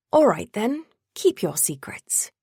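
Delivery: imitated Frodo accent, high start dropping to a whisper; Frodo Baggins. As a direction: do the hobbit's accent, slowing into a hush. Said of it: "Bet you read that with his accent".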